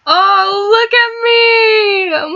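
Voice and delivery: high, very slow, choked-up cry; laughing at yourself for tearing up; teary. Real, not acted. Gamer tearing up at the scene.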